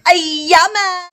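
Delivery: high, slow excited cry; excited exclamation. Real, not acted. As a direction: sing it out, delighted. Exclaims before the challenge.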